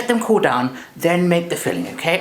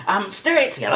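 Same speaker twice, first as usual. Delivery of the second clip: usual speaking voice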